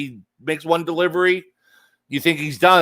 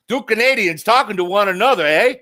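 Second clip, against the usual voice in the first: higher, hammed-up Canadian accent with a tagged-on eh; mock Canadian accent; 6 semitones higher than usual. Host teasing two Canadian guests, mock Canadian accent. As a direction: tease it like a joking Canadian.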